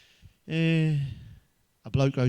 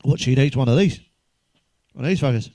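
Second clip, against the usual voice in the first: sudden shout; 13 dB louder than usual. Real, not acted. Loud outburst to audience.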